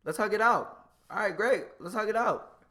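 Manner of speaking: high, loud excitement; positive, celebratory. Acted. Sharing someone's great day with a hug, loud celebration. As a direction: cheer them on, building to a shout. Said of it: "Or even positive".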